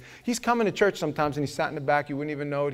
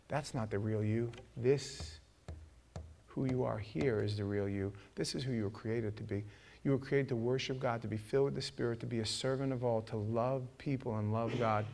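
soft, lowered, solemn sermon voice; hushed earnest preaching; 4 semitones lower, 8 dB quieter than usual. Real, not acted. Preacher tells congregation who they really are, soft and earnest. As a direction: bring it down and reassure them quietly.

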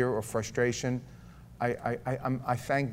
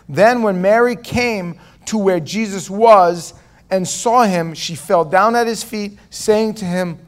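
loud, building preacher's read of the passage; forceful scripture reading; 7 semitones higher, 11 dB louder than usual. Acted. Preacher reads scripture aloud, loud and building. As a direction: read the story with mounting force to the congregation.

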